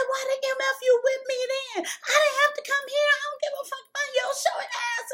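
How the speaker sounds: high, fast, raging shout; furious yelling. Retold or imitated. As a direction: go off on him. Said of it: "I said".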